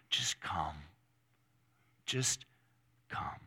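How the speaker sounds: low, soft invitation with long pauses; tender Savior. Acted. Preacher voicing the Savior to the weary, hushed tenderness. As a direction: invite them gently, letting each word hang. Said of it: "to the voice of the Savior".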